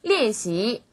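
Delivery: 练 falls, 习 rises afterwards; fourth-second tones. Shown as a demonstration. Teacher models tones for learners, 练 falling, 习 rising. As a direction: drop 练, then lift 习 steadily. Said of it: "이렇게 쭉 올라가는 발음".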